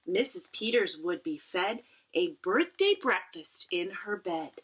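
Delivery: usual speaking voice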